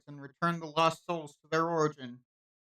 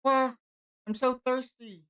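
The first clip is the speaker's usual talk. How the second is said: whiny; 9 semitones higher, slower than usual. Acted. Thirsty girl whines.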